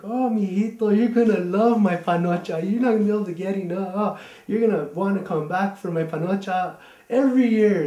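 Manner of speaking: high, loud, fast and doting; sweet grandma. Retold or imitated. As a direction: innocent grandma gushing about dessert. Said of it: "she's like".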